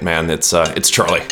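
ordinary talk, nothing marked

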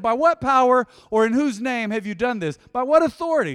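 loud, high booming question; shouted, rising council demand; preached challenge. Acted. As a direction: hurl the challenge at the room.

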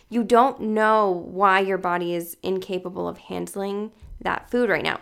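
usual speaking voice

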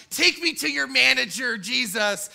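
a hint of a demanding Karen; lightly voiced entitled customer. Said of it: "[name] went full Karen".

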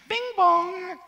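high loud bing bong chime; chime imitation. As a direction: mimic a PA chime. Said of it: "I'm going to do a silly voice for you".

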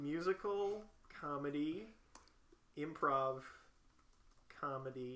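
slow, separated words, pausing as you type; typing aloud. Real, not acted. Typing skills the friend suggested, slow self-dictation. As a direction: dictate the list to yourself haltingly.

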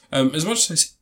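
hiccuping mid-sentence; speech broken by hiccups. Real, not acted. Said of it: "sorry, I've got hiccups now".

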